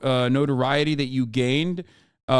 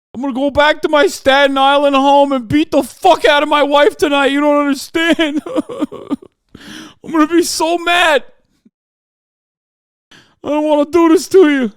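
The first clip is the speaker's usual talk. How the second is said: high, loud blubbering New York cop; shout-sob like a thick New York cop; New York cop accent; 11 semitones higher, 9 dB louder than usual. Pretend. Streamer imagines weeping New York cop arresting Trump, loud New York accent. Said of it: "You know".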